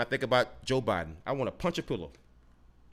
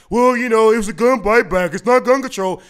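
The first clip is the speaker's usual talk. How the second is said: higher, louder, mocking nasal put-on voice; mock defender; 6 semitones higher, 8 dB louder than usual. Mocking Australians who call a buyback not gun control, in a high sneering voice. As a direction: parody the smug excuse maker.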